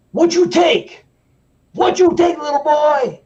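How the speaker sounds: loud, high-pitched intimidating yell; Scared Straight prisoner. Retold or imitated. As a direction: shout down at a scared kid. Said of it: "this guy was in a prison".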